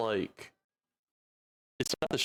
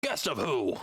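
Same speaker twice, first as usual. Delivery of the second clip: goblin bad at common. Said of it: "he's not good at common".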